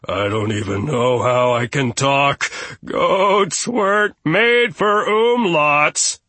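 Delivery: high, loud, drawn-out goat lament; wailing. A narrator's reading. Frightened goat Gustav excuses himself, wailing. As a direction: wail in helpless protest. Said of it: "and wailed".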